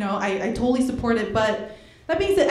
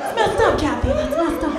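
mom voice; 6 semitones higher than usual. Retold or imitated.

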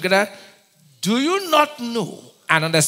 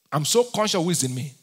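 usual speaking voice

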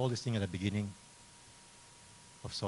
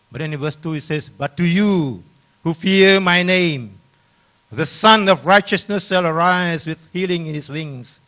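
loud, raised preaching voice; thundering pulpit reading, key words drawn out; forceful scripture proclamation; 5 semitones higher, 15 dB louder than usual. Acted. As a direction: proclaim the verse at full force. Reads scripture at full force.

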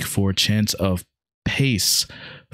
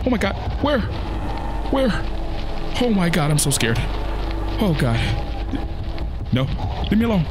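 much higher frightened pleading; real panic; 11 semitones higher than usual. Real, not acted. Player cornered by Grimace, high terrified cries.

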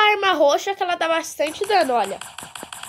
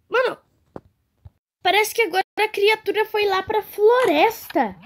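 ordinary talk, nothing marked

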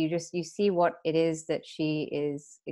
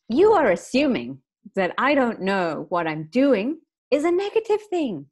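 much higher, louder indignant retort; defiant snap; 5 semitones higher, 6 dB louder than usual. Retold or imitated. Snapping back at the partner's request, defiant and loud. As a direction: bristle back like a rebel.

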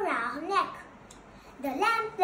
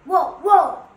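loud imitated dog barks; two sharp, loud barks; dogs barking; 3 semitones higher, 12 dB louder than usual. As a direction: bark "woof, woof" forcefully. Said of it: "He brought along a few dogs".

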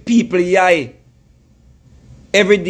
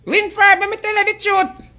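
much higher, whiny mimic of a heartbroken woman; pleading falsetto mock of a wounded lover; mock Aisha; 10 semitones higher than usual. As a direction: squeak it out like a lovesick woman moaning to her man. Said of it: "You ever hear Aisha out about".

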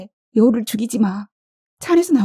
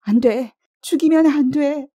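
high, pleading cry; alarmed, high-pitched objection; desperate protest; 10 semitones higher than usual. A narrator's reading. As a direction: beg them to stop, pitch leaping up. Acted plea during the attack.

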